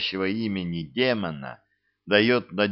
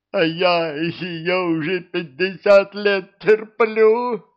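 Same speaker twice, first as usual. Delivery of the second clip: high, slow, loud sobbing wail; 9 semitones higher, 6 dB louder, slower than usual. A narrator's reading. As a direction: wail it out through tears. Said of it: "зарыдал на полу демон".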